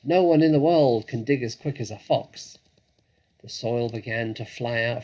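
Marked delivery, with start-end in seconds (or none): 0.0-2.6 louder, keen cry; rally the family; rousing shout. Acted. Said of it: "shouted Mister Fox".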